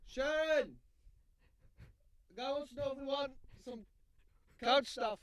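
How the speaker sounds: high yell into the next room; shouting across the house. Pretend. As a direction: call out to someone far away. Yelling to wife offstage.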